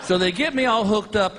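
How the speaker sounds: usual speaking voice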